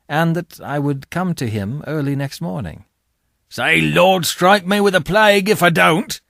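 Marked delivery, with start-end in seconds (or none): 3.5-6.2 forceful demand. Acted. Convict demands an oath.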